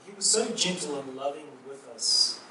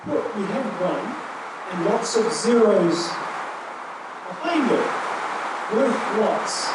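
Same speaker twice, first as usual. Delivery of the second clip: louder, higher, lively teaching voice; excited retelling; 4 semitones higher, 10 dB louder than usual. Retold or imitated. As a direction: proclaim the lesson with delight.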